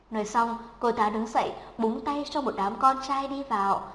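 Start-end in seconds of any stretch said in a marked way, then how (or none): none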